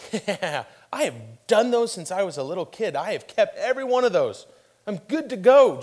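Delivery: hammed-up eager confidence; excited rich man. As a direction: boast eagerly, rising as you go. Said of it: "gets excited and goes".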